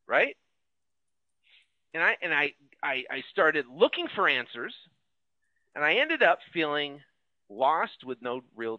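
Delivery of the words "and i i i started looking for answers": usual speaking voice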